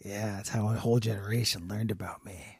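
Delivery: low, soft bedroom voice; murmured self-important come-on; mock-sultry puffer fish. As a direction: stay in the deep, quiet act. Still in puffer fish character.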